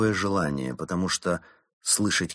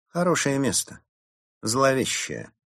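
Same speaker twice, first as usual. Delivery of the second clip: higher-pitched, assured character voice; matter-of-fact Berta; 6 semitones higher than usual. A narrator's reading. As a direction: explain it as if it were a selling point. Berta voiced explaining the ominous spot. (уверенно, высоким голосом)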